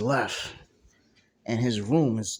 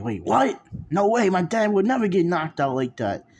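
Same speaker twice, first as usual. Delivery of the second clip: loud disbelief; 8 semitones higher, 7 dB louder than usual. Acted. Luffy denies dad was beaten.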